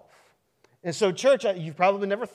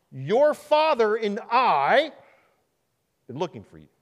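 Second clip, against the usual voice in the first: scolding mother; 4 semitones higher, 5 dB louder than usual. Acted. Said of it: "Jesus looks at the two of them".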